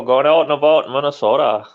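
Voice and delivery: put-on loud Minnesota accent, out and about; a hammed-up Minnesotan; mock Minnesota accent. Repeating the Minnesota joke, loud mock accent. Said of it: "It's true though".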